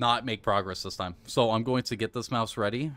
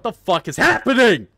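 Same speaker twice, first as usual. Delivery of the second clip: loud, higher outburst of disbelief; exasperated shout; 8 semitones higher, 10 dB louder than usual. Real, not acted. Gamer yelling as the game goes wrong, exasperated shout. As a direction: yell it, building loud toward the last word.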